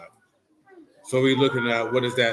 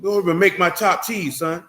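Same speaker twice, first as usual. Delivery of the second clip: higher, louder put-on voice, half joking; mock tough guy at home; 6 semitones higher, 4 dB louder than usual. Teasing a friend by acting out his swagger at home, mock bossy voice. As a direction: ham up a bossy man barking orders at home. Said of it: "walking in the house like this".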